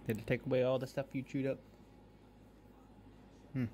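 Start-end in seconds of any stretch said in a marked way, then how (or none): none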